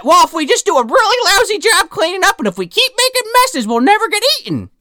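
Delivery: very high, excited scheming; eager boy. Retold or imitated. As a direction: pitch the clever plan.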